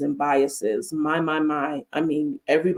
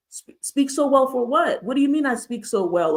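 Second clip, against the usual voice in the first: higher, disbelieving comeback; acted bafflement, pitched up; incredulous challenge; 6 semitones higher than usual. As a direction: question the compliment with mild mock affront. Acts incredulous comeback to compliment.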